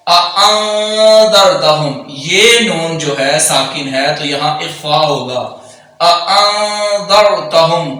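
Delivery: اانذرتہم with the ن hummed in the nose; hidden nasal. Shown as a demonstration. Teacher shows the ikhfa slowly. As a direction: hide the ن nasally.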